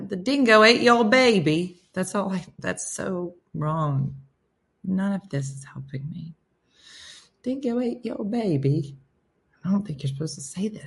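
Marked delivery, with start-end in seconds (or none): 0.1-1.8 put-on a clumsy try at an Aussie accent, pitched up; hammed-up Australian line; attempted Australian accent. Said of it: "I don't even know how to sound Australian". 7.4-8.9 lightly put-on Aussie voice, higher; mock Australian quote; Australian accent attempt. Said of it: "Do I sound Australian?".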